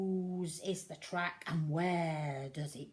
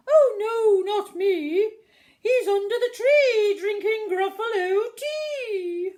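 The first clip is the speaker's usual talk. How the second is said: high, very loud wailing fox; a sly fox fobbing the child off; loud high whining fox; 16 dB louder than usual. Acted.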